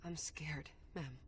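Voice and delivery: low, hushed and fearful; frightened confession. Acted. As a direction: admit the fear softly, half whispered. Admits fear before facing the prince.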